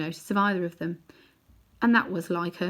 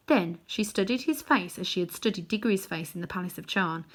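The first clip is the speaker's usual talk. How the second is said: ordinary delivery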